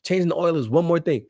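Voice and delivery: high-pitched mock complaint; imitated brother's excuse. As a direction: mimic a put-upon teenager making excuses. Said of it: "In his brain, he said".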